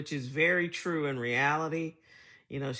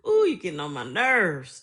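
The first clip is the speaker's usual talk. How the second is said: louder mock scolding from a country mom; mom's scolding; 3 semitones higher, 9 dB louder than usual. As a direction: imitate mom's fed-up scolding. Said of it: "That was her number one thing".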